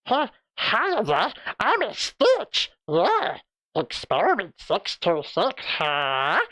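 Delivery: high, slow alien creature voice; gravelly little alien, slowed right down; Stitch voice. Shown as a demonstration. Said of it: "if people want me to do Stitch from Lilo and Stitch".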